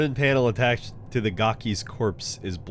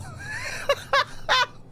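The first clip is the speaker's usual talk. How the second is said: squeaky, cracking laugh; high laugh. Real, not acted. Laughs at game obstacle.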